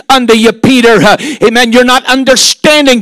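normal talking voice

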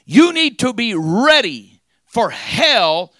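loud, high fiery sermon; preaching at full force. Acted. Preacher rousing the church, loud and fiery. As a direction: drive it home to the congregation.

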